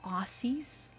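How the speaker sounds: high questioning Aussies with a broad a; mock wrong Aussies. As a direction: imitate a tourist saying Aussies. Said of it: "whenever I hear it".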